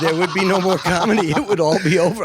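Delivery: mock doom. Mock doom about comedy ending.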